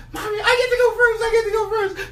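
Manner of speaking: high, fast, laughing chick voice; giddy kid getting first turn; thrilled baby eagle. Acted. Eaglet climbs onto Mommy's back, high giddy laugh.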